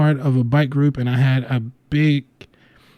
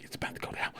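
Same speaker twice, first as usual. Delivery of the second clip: whispered aside; 21 dB quieter, much faster than usual. Retold or imitated. Whispered aside during proposal story.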